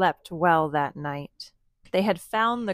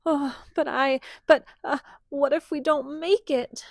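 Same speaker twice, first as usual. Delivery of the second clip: high, hesitant frightened queen; a scared little queen, lightly voiced; trembling queen; 7 semitones higher than usual. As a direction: stammer out her fear. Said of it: "The queen bee trembled".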